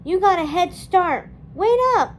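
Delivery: loud, rising to a high cry; calling out. A narrator's reading. Dawn calling after Felipa, loud and pleading. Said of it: "Dawn called out".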